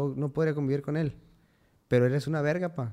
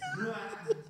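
a sudden high burst of laughter; 6 dB quieter than usual. Real, not acted. Laughing at his own claim of being faithful, high laugh.

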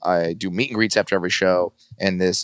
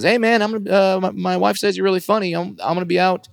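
1 lightly voiced Chris Jericho; 10 semitones higher than usual. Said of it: "he DMs me and says".

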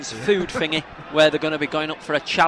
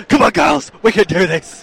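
loud rousing pep talk; rally the team, loud; motivational team talk; 3 semitones higher, 11 dB louder than usual. Retold or imitated. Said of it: "getting a team talk like it's fucking Jerry Maguire".

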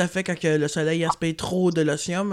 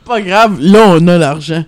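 loud sarcastic echo of his words; mocking repeat; 15 dB louder than usual. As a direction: throw his line back at him, mocking. Mocks partner's words back loudly.

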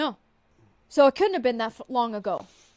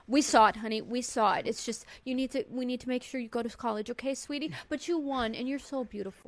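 soft, gentle motherly coaxing; soothing mom; 9 dB quieter than usual. Retold or imitated. Mother softly consoling her daughter after the loss, gentle. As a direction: comfort her quietly like a mom.

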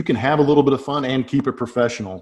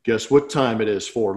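normal talking voice

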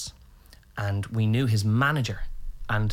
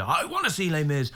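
put-on higher, childlike insistence; mock stubborn demand; 6 semitones higher than usual. Dad refuses other shows, mock stubborn demand. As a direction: demand it like a kid.

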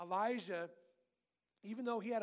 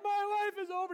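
much higher, louder hammed-up wail; mopey overdramatic; 10 semitones higher, 8 dB louder than usual. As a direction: play Elijah sulking like a child. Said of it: "got all sad and mopey and overdramatic".

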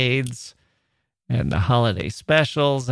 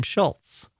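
usual speaking voice

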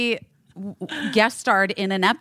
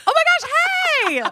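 excited greeting; much faster than usual. Retold or imitated. She greets Joey back at the game, thrilled and high. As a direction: squeal it as you spot an old friend. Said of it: "I was like".

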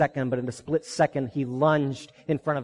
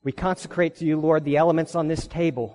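prayer. Real, not acted. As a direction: pray it gently and hushed. Leads a prayer.

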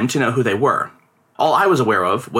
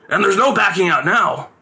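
higher, pleading father; lightly voiced pleading father; father pleads; 7 semitones higher than usual. A narrator's reading. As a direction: beg the boy to cooperate. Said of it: "his father pleaded".

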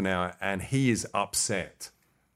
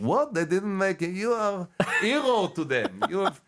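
gushing foreign interviewer; 10 semitones higher than usual. Retold or imitated. Praising the guest as a hero, high excited put-on accent. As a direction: gush with wide-eyed praise in a foreign voice. Character gushes at war criminal.

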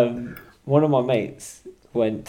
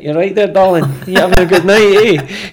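loud, higher Scottish chat-up voice; put-on Scottish accent; 7 semitones higher, 13 dB louder than usual. Man chatting up a girl in a nightclub, faked Scottish accent. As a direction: lay on a hammed-up Scottish accent. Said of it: "Oh, I'm from Scotland".

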